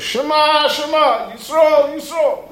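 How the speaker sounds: loud, high, each word doubled and called out; doubled Shema demo. Shown as a demonstration. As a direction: belt out every word twice, like a proclamation. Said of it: "He says like that".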